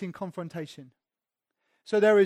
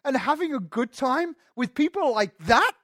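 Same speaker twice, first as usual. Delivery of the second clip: scandalised Pharisee, louder and higher each time; indignant grumbling; 6 semitones higher, 7 dB louder than usual. Retold or imitated. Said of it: "They're saying".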